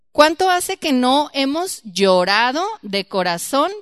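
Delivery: slow, loud, searching question; preaching plea. Acted. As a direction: press the congregation to feel it.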